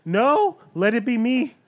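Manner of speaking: high, loud plea; pleading outcry. Real, not acted. Player volunteers to be the monster's target, pleading loudly. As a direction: cry out to be picked instead. Player begs to take the attack.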